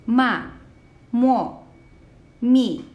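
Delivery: high, loud falling tone on "Ma, mo, mi"; fourth tone. Shown as a demonstration. Teacher showing the fourth tone, "Ma, mo, mi" each falling sharply. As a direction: start "Ma, mo, mi" high and drop straight down. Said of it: "this is a falling tone".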